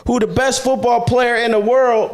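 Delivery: high, ringing preacher's voice; preaching at full force. Acted. Pastor preaches to listeners, high fervent preaching voice. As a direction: preach it out to the congregation.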